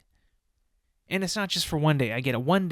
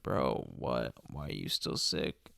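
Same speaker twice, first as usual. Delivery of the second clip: low, soft mock voice; lower, quieter imitation of a fussing coworker; imitating coworkers asking why he's still sick; mock concerned coworker; 11 semitones lower, 8 dB quieter than usual. Mimicking coworkers' concerned questions.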